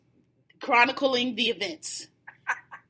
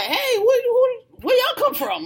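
much higher, laughing questions; mock surprised greeting; 11 semitones higher, 3 dB louder, slower than usual. Imitating a friend greeted by a crowd of girls, high and laughing. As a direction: play a guy caught off guard by girls.

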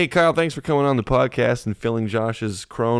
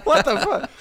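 shocked. Real, not acted. Shocked at definition.

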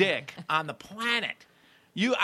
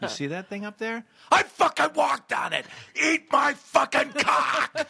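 swaggering shout; 9 semitones higher, slower than usual. Pretend. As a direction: yell it like a cocky astronaut. Arrogant astronaut bragging at party.

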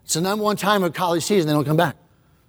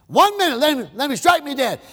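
high, taunting dare to God; mocking atheist; 7 semitones higher than usual. Preacher voicing atheist taunting the crowd, mocking. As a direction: sneer it out, daring heaven.

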